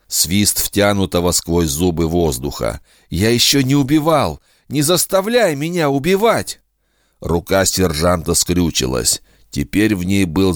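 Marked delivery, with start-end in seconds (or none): 3.1-6.6 high, pleading cry; desperate plea. Acted. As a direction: beg him not to. Begging not to be forced to kill.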